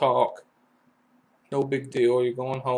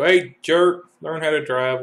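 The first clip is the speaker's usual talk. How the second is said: mock yell; 3 semitones higher, 7 dB louder than usual. Angry commuter yelling out his window at a driver, mock shout. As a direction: bark it at a bad driver, half-acted. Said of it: "Whatever you're screaming".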